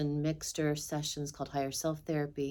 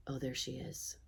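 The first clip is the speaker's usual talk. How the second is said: soft, low aside; hushed reaction; 3 semitones lower, 12 dB quieter than usual. Retold or imitated. People reacting on seeing the speaker, hushed. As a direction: say it under your breath, much softer. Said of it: "they'll get like".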